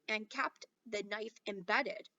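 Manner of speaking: ordinary delivery, nothing marked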